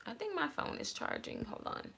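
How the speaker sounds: real distracted aside, dropped well under the talking voice; quiet aside. Real, not acted. Checking the phone mid-video, quiet aside.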